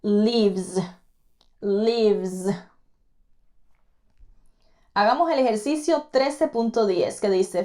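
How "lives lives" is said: "Lives" ending in a buzzing Z. Shown as a demonstration. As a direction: finish "lives" with a clear Z. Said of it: "se pronuncia como una Z al final".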